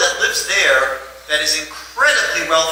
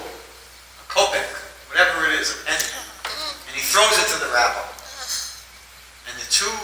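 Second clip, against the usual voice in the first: ordinary talk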